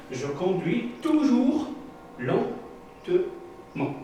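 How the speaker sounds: "lentement" drawn out very slowly and breathy; stress on lentement. Shown as a demonstration. Teacher showing pupils the adverb, drawn out very slowly. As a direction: stretch "lentement" to show slowness. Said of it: "le mot lentement".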